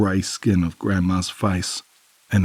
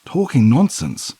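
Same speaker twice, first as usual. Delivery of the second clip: outraged disbelief; 10 semitones higher, 4 dB louder than usual. A narrator's reading.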